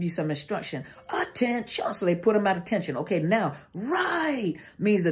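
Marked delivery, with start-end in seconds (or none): 1.1-1.9 high, stretched drill call; military command. Shown as a demonstration. Speaker showing the order after parade rest, military command call. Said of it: "the inflections of the voice let you know". 3.8-4.6 long, high-rising drill call; military preparatory command. Shown as a demonstration. Speaker showing a turn command, drawn-out military call. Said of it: "do you notice the inflection in the voice".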